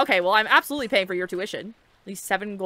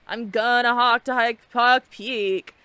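slow, louder mocking imitation; mock tourist; 2 semitones higher, 5 dB louder, much slower than usual. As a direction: drag it out like an overeager tourist. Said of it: "and they're like".

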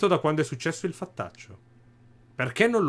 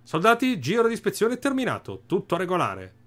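pompous commander; 7 semitones higher than usual. Acted. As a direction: bark orders like a puffed-up captain. Capo delle guardie parla ai soldati.

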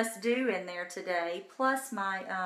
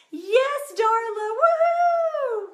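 high, loud whooping celebration; welcoming Darla onto her team with a loud cheer; excited cheer; 13 semitones higher, 9 dB louder, much slower than usual. Real, not acted. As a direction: cheer her name like a fan. Cheering a new team member.

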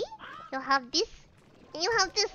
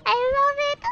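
high squeal of delight; excited; 10 semitones higher, 5 dB louder, faster than usual. Real, not acted. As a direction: gush over it. Reacting to cute slime.

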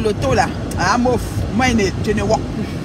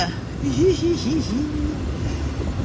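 high giggle; 4 semitones higher, 5 dB quieter than usual. Real, not acted. High laugh after teasing.

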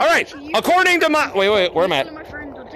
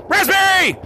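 high, excited yell; countdown scream; 6 semitones higher, slower than usual. Real, not acted. Streamer leading players in a scream on three, high shout. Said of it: "we're gonna scream raspberry on three".

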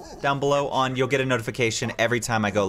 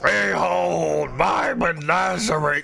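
grand announcer; 4 semitones higher, much slower than usual. Pretend.

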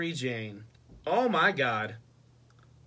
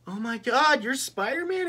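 high, hammed-up shocked Mary Jane; mock astonishment; 10 semitones higher, 3 dB louder than usual. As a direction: imitate a gushing, amazed discovery. Mock-voices Mary Jane's astonishment.

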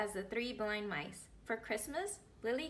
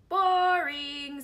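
much louder, higher drawn-out scoff; dismissive little girl; bored Lily; 5 semitones higher, 11 dB louder than usual. Acted.

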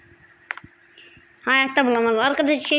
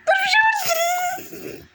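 very high, slow, crying shout; real frightened shout; alarmed cry; much slower than usual. Real, not acted. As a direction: stop everyone in fright. Sudden alarm in game.

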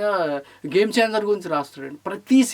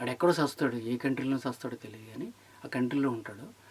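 sneering whisper; whispered scorn, much lower; whispered aside; 7 semitones lower, 11 dB quieter than usual. Real, not acted. Sneering aside about a reviewer.

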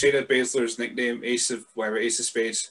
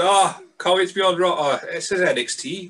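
higher, louder, play-acted eagerness turning to confusion; mock entrance excitement; 6 semitones higher, 4 dB louder than usual. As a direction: act out a wrestler caught off guard. Acting out a confused ring entrance.

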